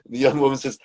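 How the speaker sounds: ordinary talk, nothing marked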